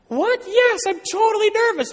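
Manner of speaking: very high, loud panicked squeal; hammed-up nervous dad, voice shooting up; flustered outburst. Flustered father answering son's question.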